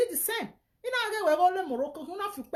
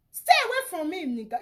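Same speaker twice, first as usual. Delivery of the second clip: angry shout; 3 semitones higher, 4 dB louder than usual. Real, not acted. Man warning his critic to keep away, shouting. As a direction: bark it at him as a final warning.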